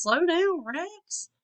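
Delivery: high, startled warning to slow down; pleading passenger calling out; high alarmed plea. Acted. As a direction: cry out for him to slow down. Stego scolding Rex at speed.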